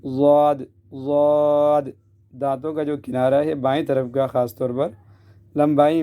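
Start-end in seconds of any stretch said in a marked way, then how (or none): 0.0-1.8 drawn-out heavy Daad; molar-side Daad. Shown as a demonstration. Teacher recalls tongue edge on molars, stretching heavy Daad. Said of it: "داڑھوں سے لگا رہے تھے".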